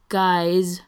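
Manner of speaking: loud, exasperated call; frustrated. A narrator's reading. Ryan interrupts his kissing bandmates, frustrated. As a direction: call them to order, fed up. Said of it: "Ryan sounds frustrated".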